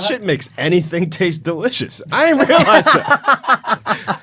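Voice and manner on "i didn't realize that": much higher, slow, laughing; astonished, drawing it out; amazed. Real, not acted. Amazed at MSG.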